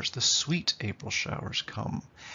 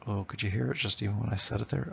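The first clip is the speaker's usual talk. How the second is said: ordinary delivery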